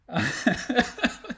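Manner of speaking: high laughing burst. Real, not acted. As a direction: laugh it off, amused at yourself. Laughs after cursing the scene.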